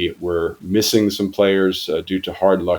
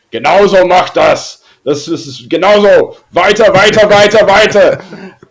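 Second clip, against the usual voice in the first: loud, high-pitched touchline shouting in German; Horst Rübesch screaming; 12 semitones higher, 9 dB louder than usual. Retold or imitated. Coach to his players on the pitch, screamed impression. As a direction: imitate a coach yelling urgent orders across the pitch. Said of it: "shouting at his Mädels, uh, screaming".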